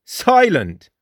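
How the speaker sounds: high, incredulous scoff. A narrator's reading. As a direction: throw the word back in disbelief. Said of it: "scoffed Jake".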